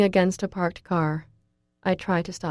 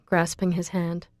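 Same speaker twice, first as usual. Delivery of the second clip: usual speaking voice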